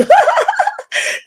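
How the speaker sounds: squealing laugh, real; high burst of laughter. Real, not acted. Laughing at the retold clapback.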